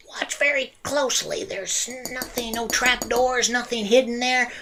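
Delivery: high, mysterious showman voice; high-pitched stage magician; magician's patter. Acted. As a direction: draw the audience in with a high voice. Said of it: "and he goes".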